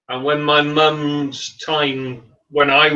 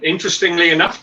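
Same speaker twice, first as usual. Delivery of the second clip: ordinary talk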